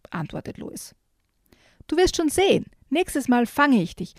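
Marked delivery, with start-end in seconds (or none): 1.9-4.1 playful call. Acted. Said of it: "ruft Jojo".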